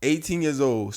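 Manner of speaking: ordinary talk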